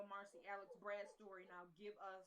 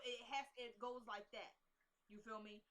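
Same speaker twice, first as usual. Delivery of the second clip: ordinary talk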